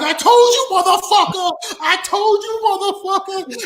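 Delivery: loud, very high, hammed-up shouting; triumphant angry yelling. Wrestler yelling over the bully he dropped, triumphant fury. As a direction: crow furiously over a beaten man. Winner yells at man he hit.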